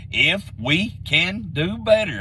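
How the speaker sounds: If we can do better, each word drawn out slowly; slow it right down so it sinks in. Shown as a demonstration. To fans doubting the coaching change, spelled out very slowly. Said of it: "let me say that very slowly".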